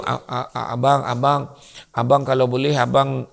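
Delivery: low, hesitant stammer; timid husband. Acted. Husband nervously asking his wife a favour, stammering timidly. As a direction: stumble over a request, afraid to ask.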